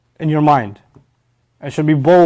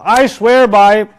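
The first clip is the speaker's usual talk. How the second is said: loud, higher, drawn-out declaration; solemn oath; 6 semitones higher, 7 dB louder, much slower than usual. Acted. Someone of importance takes an oath, solemn and emphatic. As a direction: swear it slowly and emphatically.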